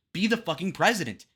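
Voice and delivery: usual speaking voice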